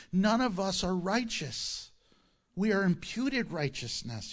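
ordinary delivery

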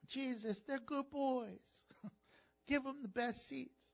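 soft, slow mock mafia mother; lightly voiced mother asking for her boys; Italian mafia mother. Mother pleading with Jesus for her sons, in a soft slow mock mafia voice. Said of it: "he sounded like an Italian mafia".

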